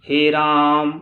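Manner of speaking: हे राम called out long and loud; prolonged call. Shown as a demonstration. Teacher showing pluta, calling out हे राम long. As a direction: draw out हे राम like a far call. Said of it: "लंबे समय तक किसी को हम आवाज़ देते हैं".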